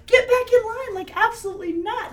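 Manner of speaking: loud, laughing high shout. Retold or imitated. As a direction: yell through laughter. Calling friend back, laughing.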